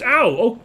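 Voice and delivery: sharp yelp of pain. Real, not acted. Gamer yelping when an enemy hits. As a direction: yelp as the hit lands.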